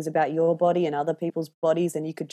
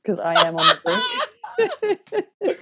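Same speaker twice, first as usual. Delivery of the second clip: mock warning; 4 dB louder, much slower than usual. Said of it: "be like".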